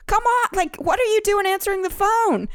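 high, teasing reproach; high mock scolding. Caller teasing the groom for answering, high mock scold. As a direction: scold him in a squeaky playful voice. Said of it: "I was totally like".

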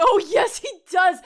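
loud, high, delighted yes; excited agreement. Real, not acted. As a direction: burst out in eager agreement. Emphatic agreement.

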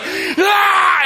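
high shouted ah; hammed-up attacker's cry; high mock yell. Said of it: "she's like".

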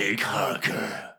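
slow, jaw jutting forward; underbite voice. Shown as a demonstration. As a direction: push the jaw out and speak from the neck. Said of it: "push your jaw forward".

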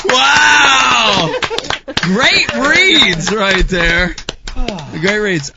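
loud, high, drawn-out cheer; excited praise. Real, not acted. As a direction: hail the performers with big, slow enthusiasm. Host applauds the script reading.

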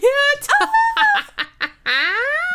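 high, loud cackling laugh; gleeful cackle. Real, not acted. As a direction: cackle with glee. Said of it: "stop cackling".

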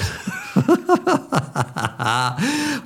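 a quiet laugh that drifts off; soft delighted laugh. Real, not acted. Preacher telling the congregation the money came back, quiet laugh. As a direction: chuckle softly at the happy ending. Money returned after fast.

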